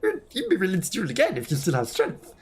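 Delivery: high-pitched, formal game character; courteous duelist character. Acted. Character asks a weakened sister about another duel, courteous high-pitched role voice. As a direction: voice a polite, high-voiced challenger.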